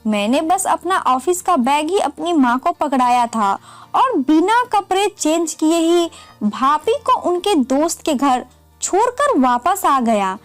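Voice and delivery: ordinary delivery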